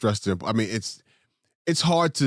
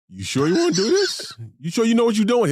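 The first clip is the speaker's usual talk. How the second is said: put-on higher, louder questioning old man; imitating grandfather; 11 semitones higher, 5 dB louder than usual. Grandfather warning grandson before his wedding, high loud mimic. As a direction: voice Pop asking if you're sure. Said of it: "he's like".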